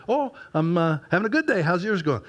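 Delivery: greeting a churchgoer, bright and eager; cheerful church voice. Retold or imitated. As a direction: perk up for a church member. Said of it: "if that had been an average couple person from church".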